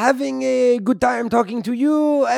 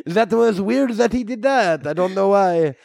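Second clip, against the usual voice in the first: normal talking voice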